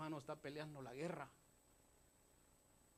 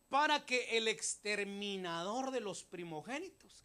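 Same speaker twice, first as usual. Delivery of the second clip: loud, slow, raised-voice scripture proclamation; full-force preaching; 5 semitones higher, 11 dB louder, slower than usual. Real, not acted. Preacher reading Hebrews to the congregation, full-force. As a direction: proclaim the verse at full volume, stretching the key words.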